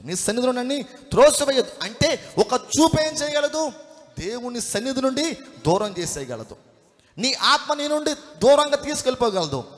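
high, loud sermon cry; full-force preaching. Real, not acted. Preacher warning the congregation what one look can do, full-force preaching.

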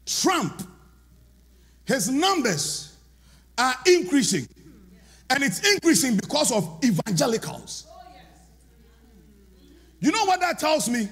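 high, loud, rousing preacher; rally the church, shouting it out; preaching at full force. Acted. Preaching to congregation.

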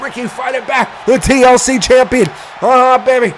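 high, fired-up wrestling commentary; excited play-by-play. Acted. Commentator calling a wrestling fight, excited. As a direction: call the action at a high pitch.

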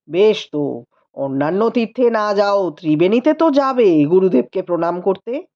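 tired rider brushing off questions; lightly voiced tired husband. A narrator's reading. Rupendra answering the sister-in-law, mildly weary.

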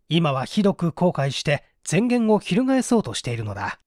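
ordinary delivery

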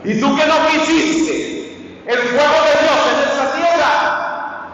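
high, loud sung-out warning; preaching shout. Acted. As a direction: thunder the warning from the pulpit. Preacher warns of God's fire. (grito de predicador)

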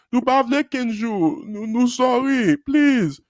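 slow, high Creole pleading; whiny, drawn-out begging; mock crying. Two Haitian boys beg their teacher in Creole, mock crying. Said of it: "crying in front of the whole class".

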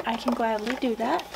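usual speaking voice

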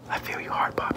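unvoiced breathy whisper. Girl whispering in his ear at the bleachers, whispered. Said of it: "whisper in my ear".

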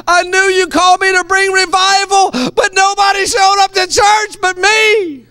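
shouting a frustrated prayer up high; mock-pleading prayer at full voice; mock desperate prayer. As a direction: cry out to God, hammed-up complaint.

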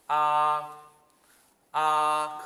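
"아" held long, high and level; first tone. Shown as a demonstration. Teacher leading learners to repeat, high level tone. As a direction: sing out "아" on one steady high note. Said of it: "일 성".

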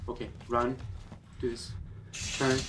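normal talking voice

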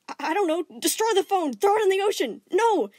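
high, loud frantic second voice; panicking gem. Acted. His gem panics about the phone call, shrieking high. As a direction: shriek out wild ideas in panic.

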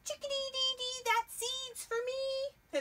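high chirpy chickadee claiming seed. Acted. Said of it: "This chickadee says".

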